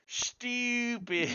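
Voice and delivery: put-on "Stupid" high and long, "stoo-pid" in an Australian accent; a mock Aussie "Stupid". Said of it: "My favorite Australian word of all time".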